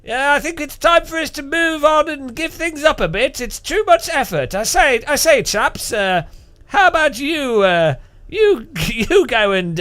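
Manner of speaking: put-on high, weary old-empire toff; mock imperial Brit. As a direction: play a hammed-up British imperialist, high-pitched. Mock British Empire handing over world rule.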